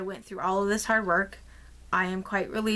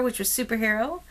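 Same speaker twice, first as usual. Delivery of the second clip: usual speaking voice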